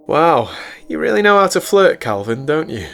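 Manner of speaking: high, loud, teasing woman's voice; mock-impressed, pitched well up; Celine's sarcastic voice. Narrator voices Celine's teasing reply.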